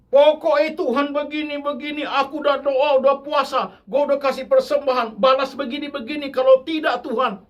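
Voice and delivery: loud, high, demanding whine; childish threatening prayer. Retold or imitated. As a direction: pester God like a spoiled child. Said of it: "wah, main ngancam". (rengekan menuntut yang keras dan tinggi)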